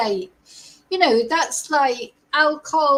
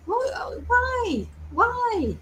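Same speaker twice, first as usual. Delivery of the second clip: incredulous; 10 semitones higher, 4 dB quieter, much slower than usual. Real, not acted. Baffled by the drug combination.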